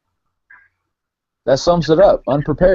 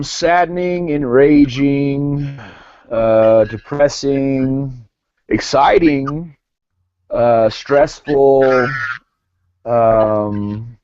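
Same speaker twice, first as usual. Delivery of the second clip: slow deliberate list; much slower than usual. Real, not acted. Slowly lists his feelings.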